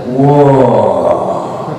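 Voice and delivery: ordinary talk, nothing marked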